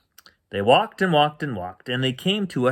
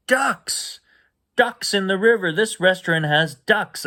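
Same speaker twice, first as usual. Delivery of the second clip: high, excited squeal of discovery; a little girl thrilled to spot ducks; wide-eyed kid excitement; delighted child; 7 semitones higher than usual. Acted.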